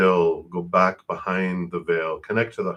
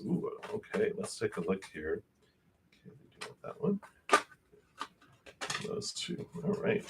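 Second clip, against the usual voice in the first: whispering to herself; 12 dB quieter than usual. Real, not acted. Muttering while picking cards.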